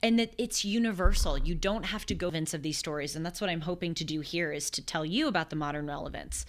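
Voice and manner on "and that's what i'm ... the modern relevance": ordinary talk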